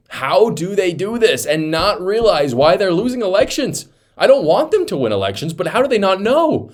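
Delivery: high, loud incredulous outburst; exasperated disbelief. Real, not acted. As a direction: throw your hands up in loud, high-pitched disbelief. Host exasperated at Democrats' clip.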